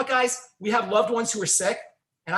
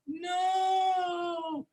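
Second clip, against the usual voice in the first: the inner voice cries out no much higher; inner voice shouting no; 11 semitones higher, 4 dB quieter than usual. Retold or imitated. Inner voice stopping you grabbing food, high shouted refusal. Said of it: "that still small voice will go".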